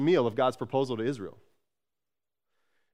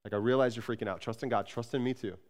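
softer, gentle reassurance to scared followers; tender Jesus; 4 semitones lower, 7 dB quieter, faster than usual. Retold or imitated. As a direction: soothe frightened disciples, hushed and kind. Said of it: "he just is tender here".

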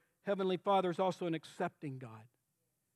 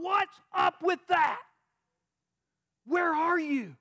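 high raised anguished shouting at God; preacher reliving yelling at God on the frozen lake; retold yelling at God; 7 semitones higher than usual. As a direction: shout your hurt at God. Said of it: "I screamed and yelled at God".

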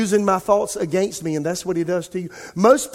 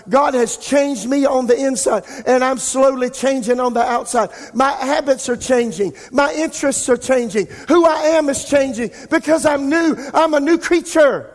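rising declaration; 6 semitones higher than usual. Acted. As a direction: proclaim it stronger each line. Preaching builds to full force.

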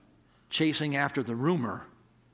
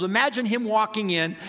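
ordinary talk

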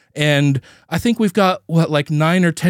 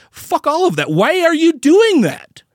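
incredulous outburst; 10 semitones higher than usual. Retold or imitated.

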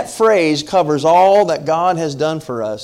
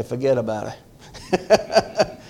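New York saying; 4 semitones lower, 4 dB quieter, slower than usual. Retold or imitated. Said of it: "there's a saying in New York City".

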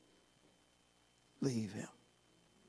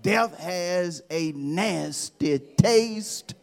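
dramatic slow; much slower than usual. Acted. Slow dramatic sermon line.

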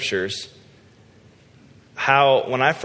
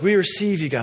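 mock prayer leader; 9 semitones higher than usual.

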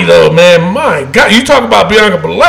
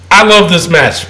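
ordinary delivery

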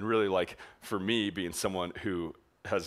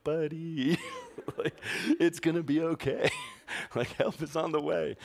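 higher, laughing comfort from a distance; mock reassurance; 8 semitones higher than usual. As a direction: fake soothing voice, breaking into laughs. Comforting kid from feet away.

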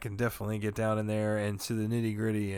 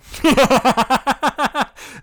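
loud laugh; 12 dB louder than usual. Real, not acted. Laughing at his own joke.

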